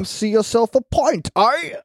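high, hammed-up Brit-Australian accent; a bad mock British pub accent. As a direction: toss off a pint offer in a jokey British accent. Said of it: "That was, like, Brit Australian?".

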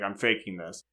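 mock deep voice. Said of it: "so it's easier to be like".

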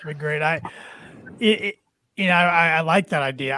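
ordinary delivery

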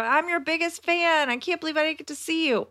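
gushing fan. Retold or imitated. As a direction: gush like a starstruck fan.

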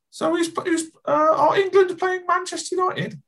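very high, confused mother-in-law voice; mother-in-law character. Acted. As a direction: ask cluelessly in a squeaky put-on voice. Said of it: "the classic one is always".

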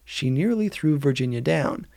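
ordinary delivery

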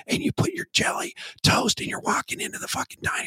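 breathy mock-ASMR whisper. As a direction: whisper it close to the mic, hammed-up. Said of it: "I'm just gonna go A S M R".

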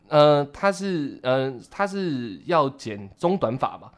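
very slow, careful, holding back anger; restrained. Retold or imitated. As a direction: ask slowly and politely, biting back rage. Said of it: "这已经是我的最后的理智了". (压着火气慢慢地问)

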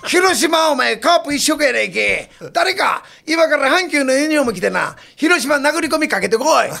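shouting orders at the staff from across the room; bossy shout. Retold or imitated. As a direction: bellow it like an army-style producer. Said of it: "その小銀君一いうのが".

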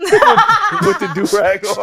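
drawn out through laughter; slow words cracking up; laughing slowly. Real, not acted.

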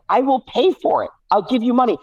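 high pleading kid voice, imitated; imitating pleading child. Dad voicing Violet pleading to pay, high and childlike. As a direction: play the little sister begging to pay. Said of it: "Violet's like".

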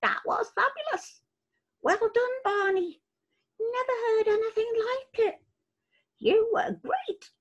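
high chirpy bird voices; small bird voices. Acted. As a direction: speak as a cluster of little birds. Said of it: "several birds came up to Barney and said".

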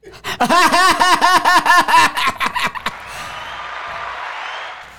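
cracking up at his own punchline; high, loud laugh at his own joke. Real, not acted. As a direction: burst into high delighted laughter.

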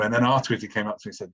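ordinary delivery

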